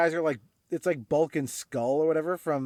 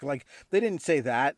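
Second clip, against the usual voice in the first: ordinary talk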